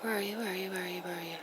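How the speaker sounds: soft whispered searching. Real, not acted. To herself, hunting for the planets, whispering. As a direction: breathe it while hunting through the lens.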